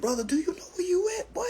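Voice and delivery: high, fast, disbelieving question; squeaky-high incredulous comeback; incredulous retort. Retold or imitated. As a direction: pitch it way up in disbelief. Incredulous retort to screaming inmate.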